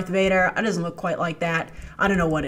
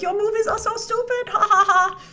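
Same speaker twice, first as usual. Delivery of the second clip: much higher, odd voice with mocking laugh; mock Palpatine cackle; 11 semitones higher than usual. Palpatine laughing at Rey, hammed-up villain. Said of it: "He's like".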